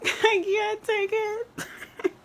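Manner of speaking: high, slow, drawn-out wail; overwhelmed squeal through laughter; about to cry. Real, not acted. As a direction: wail it as if it's too much to take. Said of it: "Guys, I'm literally gonna cry".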